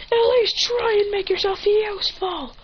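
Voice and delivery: high, strained mock battle shout; hammed-up furious screaming; angry yell. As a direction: hurl the insult, dragging out the last word. Yelled insult during fight.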